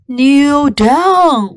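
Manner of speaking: ordinary delivery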